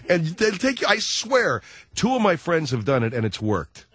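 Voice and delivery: ordinary delivery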